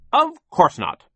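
high, loud brusque denial; blustering, emphatic reply; overbearing boss. A narrator's reading. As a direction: dismiss the idea loudly. Sir answers Charles.

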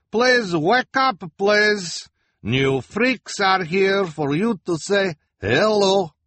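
put-on high, loud, slow accented boss voice; bossy fortune-teller, slow and loud; Madame Lulu calling. A narrator's reading. Lulu wakes the freaks.

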